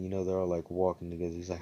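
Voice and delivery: ordinary talk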